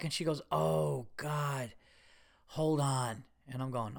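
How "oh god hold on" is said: secretary's concerned "oh", sighed out with pauses. Retold or imitated. Secretary putting a patient on hold, mildly concerned. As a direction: imitate a worried secretary, understated. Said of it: "in a concerned way".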